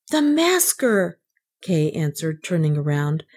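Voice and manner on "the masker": high, slow announcement; confident child. A narrator's reading. Kay answers the policeman, glad and unafraid. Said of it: "not a bit afraid".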